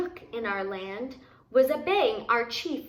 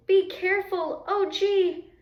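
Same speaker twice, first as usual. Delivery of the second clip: higher, anxious call; shouting in alarm; 8 semitones higher than usual. A narrator's reading. Boy to Grandpa, a higher anxious warning call. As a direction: cry out a warning. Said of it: "I shouted".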